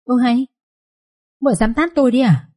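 slapped man's astonished high protest; startled protest. Acted. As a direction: yelp out the shock of being hit. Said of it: "liền kêu ối lên một tiếng".

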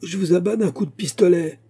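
high-pitched urgent cry; shouted threat. A narrator's reading. Musketeer threatening the fleeing rider, shouted threat. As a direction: shout a warning at a fleeing man. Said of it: "s'écria-t-il encore".

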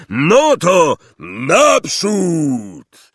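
high, very slow, loud holler; shouted command. A narrator's reading. Hagrid setting the little boats off, long shout. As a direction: launch the fleet with a long-drawn cry. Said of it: "krzyknął Hagrid ze swojej łódki".

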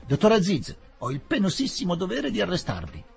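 high, strained official voice; a nervous inspector forcing out a formal arrest; shrill police inspector. Acted. Police inspector arresting the doctor, high and shrill. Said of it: "disse con voce stridula".

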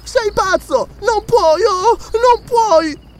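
very high, desperate woman's cries; shrieking protest. A narrator's reading. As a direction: shriek in horror as you struggle. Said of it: "strillò Belesa".

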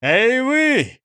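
high, sharp acted call; shouted hail. A narrator's reading. Police chief hails the firemen, shouting. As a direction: call out to them with authority. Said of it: "крикнул начальник полиции".

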